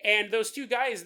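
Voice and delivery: usual speaking voice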